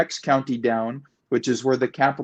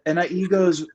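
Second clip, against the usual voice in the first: ordinary talk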